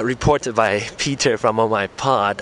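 ordinary talk

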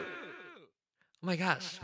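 slow echoing voice; digital echo. Real, not acted. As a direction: echo effect on the mic. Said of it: "what is this echo thingy".

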